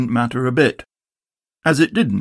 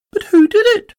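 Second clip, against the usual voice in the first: very high and louder; little Roo; 6 dB louder than usual. Acted. Roo asks who did it, very high. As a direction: pipe up like an eager joey. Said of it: "asked Roo".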